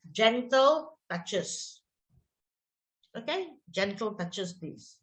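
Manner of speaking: slow, softening reminder; gentle instruction. Shown as a demonstration. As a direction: coax the child kindly. So instead.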